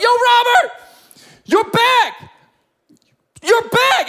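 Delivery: high, loud yell of astonishment; amazed excited shout. Acted. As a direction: cry out to a friend in disbelief. Acts out Ted seeing friend healed.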